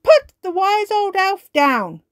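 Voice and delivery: high, loud commanding shout; shouting order. Acted. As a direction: order the robot firmly. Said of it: "shouts Ben".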